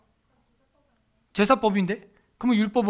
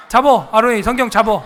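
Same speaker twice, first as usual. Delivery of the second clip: laughing urging; 2 semitones higher, 8 dB louder than usual. Acted.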